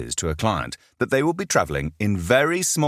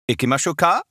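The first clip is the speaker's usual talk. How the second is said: bright, higher, louder Japanese invitation; cheerful Japanese greeting; 9 semitones higher, 4 dB louder, faster than usual. Acted. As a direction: call it out warmly to the group.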